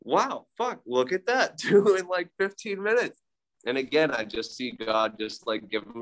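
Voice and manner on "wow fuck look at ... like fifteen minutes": high, amazed, laughing exclaim. Retold or imitated. Seeing the second coyote on his drive home, amazed and laughing. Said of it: "And I'm like".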